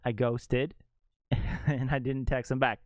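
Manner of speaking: usual speaking voice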